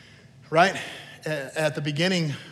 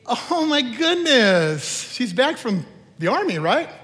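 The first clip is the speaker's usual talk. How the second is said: gushing church lady; 7 semitones higher, 4 dB louder, slower than usual. Retold or imitated. Church lady greeting returning soldier.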